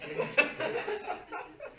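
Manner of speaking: high bursting laugh at the punchline; real amused laugh. Real, not acted. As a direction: let a high laugh break out. Laughs at own story.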